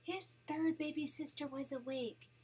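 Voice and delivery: plain reading voice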